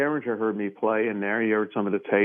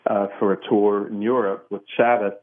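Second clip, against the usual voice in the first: usual speaking voice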